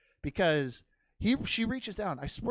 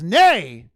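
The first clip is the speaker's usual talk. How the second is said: loud neigh, much louder; horse neigh; 12 dB louder than usual. Retold or imitated. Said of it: "the horse goes".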